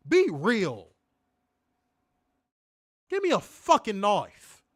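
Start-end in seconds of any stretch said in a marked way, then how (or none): none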